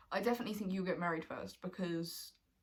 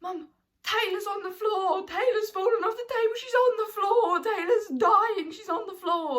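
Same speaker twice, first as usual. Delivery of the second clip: panicked yell; 12 semitones higher, 10 dB louder than usual. Retold or imitated. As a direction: scream for mum in a panic. Panicked yelling for mum.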